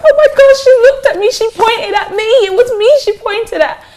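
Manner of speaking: high, loud imitation of a starstruck fan; screaming fan. Woman re-enacting her concert moment with her idols, high excited screaming. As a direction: squeal it out like an overwhelmed fangirl. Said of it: "I screamed and I screamed".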